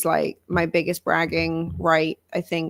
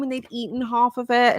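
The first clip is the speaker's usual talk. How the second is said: ordinary talk, nothing marked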